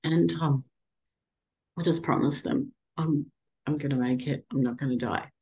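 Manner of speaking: high, tearful voice, words catching; fighting back tears; tearful promise. Real, not acted. Tearfully promising her daughters she'll survive. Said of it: "sorry, I get really emotional".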